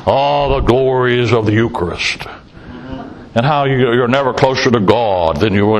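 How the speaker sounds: nasal Boston priest. Retold or imitated. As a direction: mimic the droning TV priest from Boston, lightly and low. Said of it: "talks through his nose from Boston".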